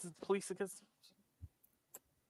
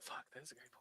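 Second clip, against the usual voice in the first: whispering; 7 semitones lower, 19 dB quieter, faster than usual. Retold or imitated. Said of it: "You're like".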